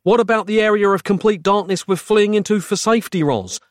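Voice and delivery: despairing question. A narrator's reading. Narrator to Roz while fleeing into darkness, despairing. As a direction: plead for an answer. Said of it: "I asked, despairing".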